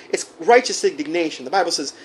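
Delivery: ordinary talk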